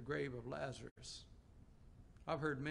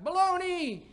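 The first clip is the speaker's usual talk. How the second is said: loud, high mimicked echo; echo yelling back; 14 dB louder than usual. Echo answering the boastful preacher, high loud yell. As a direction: holler it back as the canyon's answer. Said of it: "And the echo came back".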